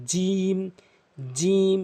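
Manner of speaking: জিম with tongue middle on palate, vowel held long. Shown as a demonstration. Teacher drilling the letter, drawn-out জিম. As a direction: press out a firm j and stretch জিম. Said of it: "জিহ্বার মাঝখানে উপরে তালুর সঙ্গে".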